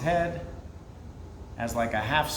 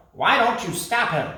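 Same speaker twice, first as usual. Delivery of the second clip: high, pushing question; parrot challenging; 7 semitones higher, 4 dB louder than usual. Acted. Parrot urging the creatures to act, high. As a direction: challenge them.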